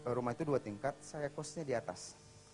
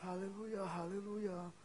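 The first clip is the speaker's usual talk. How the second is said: lightly voiced, higher praise; worshipper's praise; 7 semitones higher, much slower than usual. Boas praising in church, lightly imitated devout murmur. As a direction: draw it out as if lost in worship.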